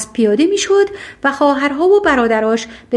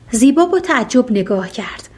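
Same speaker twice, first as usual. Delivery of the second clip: usual speaking voice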